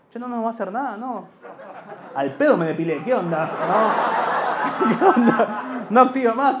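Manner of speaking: put-on nervous kid whisper-joke; scared kids. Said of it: "kids at the house".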